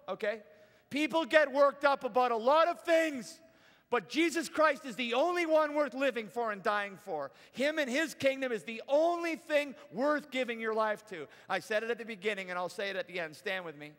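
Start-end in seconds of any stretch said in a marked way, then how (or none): 0.9-11.3 loud, raised sermon climax; impassioned preaching. Real, not acted. Preacher urging church to live for Christ, impassioned preaching. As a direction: drive the message home with conviction.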